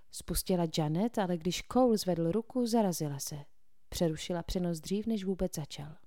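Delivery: ordinary delivery, nothing marked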